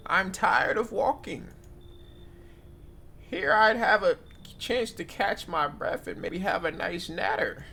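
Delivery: put-on high, slow old granny; grandma voice. Granny reassuring the host, high and slow. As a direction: coo like a cosy old granny. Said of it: "My grandma voice kinda mid".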